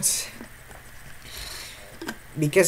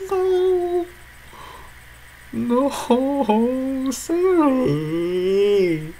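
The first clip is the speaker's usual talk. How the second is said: scared pleading; 9 semitones higher, much slower than usual. Real, not acted.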